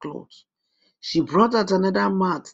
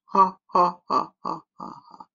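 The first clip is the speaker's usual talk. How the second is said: witch cackle; 3 semitones higher than usual. A narrator's reading. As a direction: cackle fading to breath. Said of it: "said the old witch".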